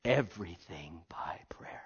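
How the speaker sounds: a hushed, breathy whisper. Real, not acted. Preacher leaning in to the congregation, whispered. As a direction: drop to a whisper, intimate.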